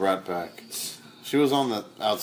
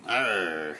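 pirate voice; 2 semitones lower than usual. Acted. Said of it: "I just made a pirate voice".

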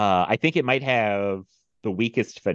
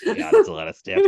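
old man imitation; 6 dB louder than usual. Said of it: "repeating the old man's line".

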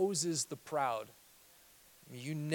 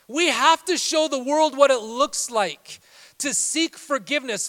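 loud, high-pitched, driving sermon; full-force preaching; 11 semitones higher, 13 dB louder than usual. Real, not acted. Pastor urging believers to stop living offended, preaching at full force. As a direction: preach it hard, voice raised throughout.

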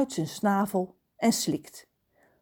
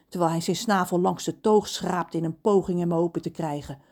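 ordinary delivery

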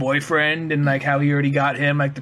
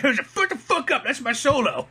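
high, drawn-out mock protest; pitched-up put-on outrage; mock indignant musician; 7 semitones higher than usual. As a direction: act out an interrupted soloist, hammed-up. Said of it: "I do like".

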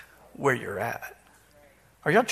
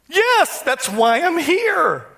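higher-pitched imitation of the church lady; imitating the lady; 9 semitones higher, 3 dB louder, much slower than usual. Pastor retelling a counseling visit, voicing the lady higher. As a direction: play her voice higher, plaintive and helpless.

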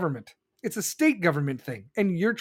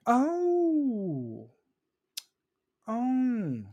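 long, high drawn-out "oh"; mock realization; 4 semitones higher than usual. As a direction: act out a slowly dawning realization. Mocking fence owner's dawning realization.

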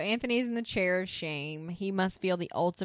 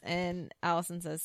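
ordinary talk

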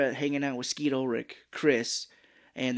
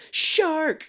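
sudden high, loud cry; high-pitched acted yell; mock startling shout; 5 dB louder than usual. Acting out a taunt at diver.

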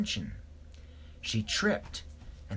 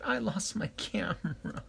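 sad; 8 semitones higher than usual. A narrator's reading. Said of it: "She was sad".

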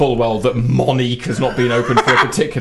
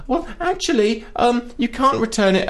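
high, prim mock shop assistant; mock clerk; 11 semitones higher than usual. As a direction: parody her know-it-all tone. Imitating the shop woman.

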